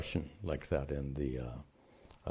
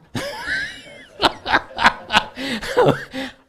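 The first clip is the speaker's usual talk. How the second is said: loud laugh; 9 dB louder than usual. Real, not acted. Laughing at own accent joke.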